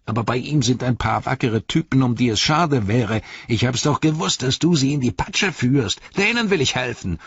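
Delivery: high, agitated orc voice; Grishnach. Acted. Grishnach accuses Ugluk.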